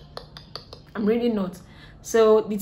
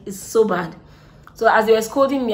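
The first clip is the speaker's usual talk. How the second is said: ordinary talk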